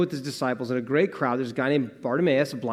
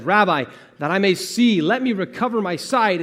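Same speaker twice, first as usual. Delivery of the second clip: loud, higher-pitched appeal; urgent plea; 5 semitones higher, 6 dB louder than usual. Acted. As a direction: beg eagerly and openly for healing. Blind man pleading to Jesus.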